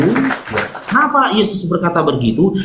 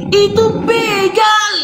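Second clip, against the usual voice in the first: exasperated; 11 semitones higher, much slower than usual. Real, not acted. Exasperated insistence to audience.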